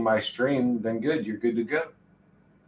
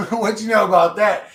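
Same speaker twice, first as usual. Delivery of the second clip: higher, louder laughing repeat with a rise; hyped repeat; 8 semitones higher, 8 dB louder than usual. Shown as a demonstration. Streamer repeating the line louder and laughing, higher. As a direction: hype it up with a laugh.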